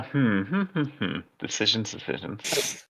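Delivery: soft trailing hmm; musing mutter. Real, not acted. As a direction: mull it over quietly. GM pondering monster move.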